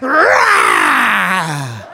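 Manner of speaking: mock angry roar. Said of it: "I was like".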